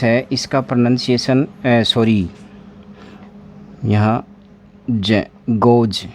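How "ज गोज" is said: ज alone, then गोज ending on it; voiced j ending. Shown as a demonstration. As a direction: slowly model ज, then गोज with a buzzed j. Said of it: "यहाँ पर जे आएगा".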